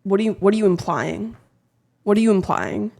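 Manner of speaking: ordinary delivery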